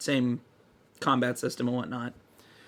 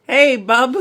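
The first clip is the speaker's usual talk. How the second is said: much higher and louder cartoon toaster; 9 semitones higher, 10 dB louder than usual. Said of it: "I don't remember how his voice sounded".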